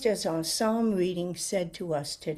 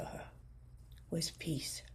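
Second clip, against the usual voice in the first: a much softer, unvoiced whisper; 3 semitones lower, 14 dB quieter than usual. Real, not acted. Pastor offering her sister peace, whispered softly. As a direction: let the word fade to a breath. Closing word of tender story.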